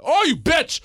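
high, loud retold outburst; shouting a retold insult; mock angry yell.

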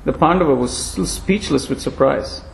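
ordinary delivery, nothing marked

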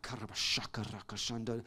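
soft, fast prayer in tongues; muttered tongues. Retold or imitated. Husband facing man who wants his wife, muttered tongues. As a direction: pray in tongues under your breath. Praying in tongues under breath.

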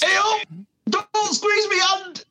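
high, slow squeaky toy whine. Pretend. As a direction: squeal it like a squeezed rubber toy. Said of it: "what would it sound like, if I squeeze?".

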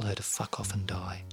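unvoiced whispered punchline; whispering. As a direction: hush it like a cheeky secret. Whispered punchline of parody poem.

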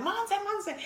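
high, eager hail; imitated fan call. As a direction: mimic a fan calling out a name. Fans calling out to her.

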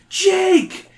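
high, loud joyful call; happy exclaim. A narrator's reading. The kids spot Jake and call out his name in joy. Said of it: "you and Liz exclaim together".

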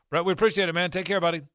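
loud, high, friendly goodbye; hearty sign-off. Real, not acted. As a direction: cheer the guest off warmly. Warm sign-off to guest.

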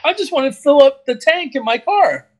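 high-pitched exasperated customer; comic indignant whine; mock protest. Acted protest at being called boss.

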